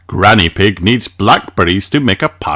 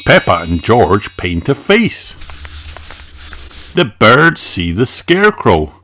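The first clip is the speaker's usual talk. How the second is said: ordinary delivery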